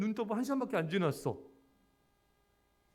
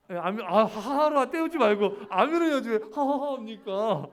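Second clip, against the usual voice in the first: louder, laughing through a playful scolding; 8 dB louder than usual. Real, not acted. Pastor teasing a congregant who laughed instead of amen, laughing loudly. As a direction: tease them warmly while chuckling.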